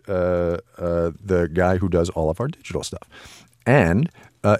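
normal talking voice

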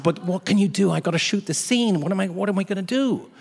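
comic desperate pleading; retold panic. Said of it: "I'm panicked at this point".

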